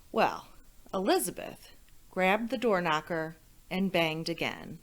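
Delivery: ordinary delivery, nothing marked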